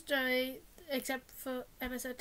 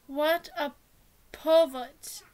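louder, disgusted exclamation; indignant outrage; 2 semitones higher, 9 dB louder, slower than usual. Acted. Boyfriend reacting to girlfriend being filmed, indignant. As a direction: say it with mild acted outrage.

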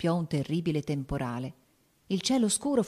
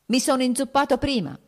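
higher and louder, snapping back; near shout; 8 semitones higher, 5 dB louder than usual. A narrator's reading. Husband half-shouts at his wife's questions. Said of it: "gridò quasi egli".

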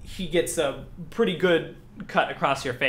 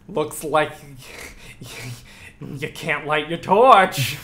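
loud, drawn-out taunting voice; funny villain voice; 6 dB louder, slower than usual. Acted. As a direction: put on a silly voice to mock the hero. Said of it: "what was that voice?".

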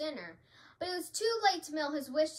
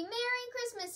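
higher-pitched glad greeting; storybook turkey cry; 10 semitones higher than usual. A narrator's reading. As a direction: call out the greeting. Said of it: "he cried".

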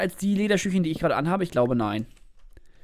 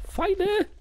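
high surprise; 12 semitones higher than usual. Real, not acted.

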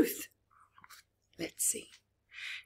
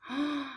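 gasp; 7 semitones higher, 3 dB quieter than usual. Acted. Reacting to scary story moment.

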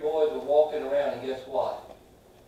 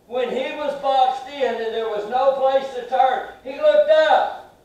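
loud, higher preacher's cry, rising to the climax; preaching at full force; 6 semitones higher, 6 dB louder than usual. Acted. Preacher rallying the congregation, full-force preaching. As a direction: preach it out and lift them to the punchline. Preacher builds to Paul looking up.

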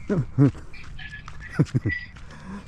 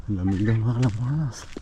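normal talking voice

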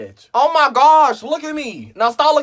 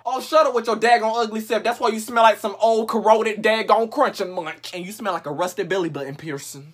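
ordinary talk

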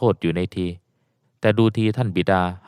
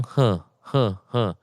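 put-on old father heaving big sighs; heavy sighs. A narrator's reading. Old father sighing heavily in relief. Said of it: "ถอนใจใหญ่".

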